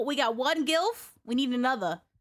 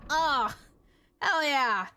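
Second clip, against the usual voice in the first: normal talking voice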